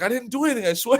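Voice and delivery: high mock protest, breaking into a laugh; protesting girl. Imitating a girl defending herself to Rico, high-pitched protest. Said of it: "this girl's like".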